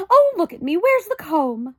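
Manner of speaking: high, loud, flustered mother; fussy mother in a rush; high panicked fuss; flustered Homily. A narrator's reading. Homily's voice in the story.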